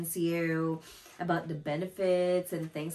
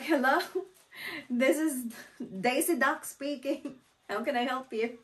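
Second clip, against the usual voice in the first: imitated prank agent; 7 semitones higher than usual. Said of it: "tried it. Like".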